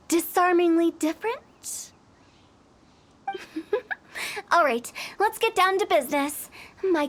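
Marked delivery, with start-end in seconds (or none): 3.3-3.9 soft high chuckle; a quick amused laugh. Acted. Laughs at being called weirdo.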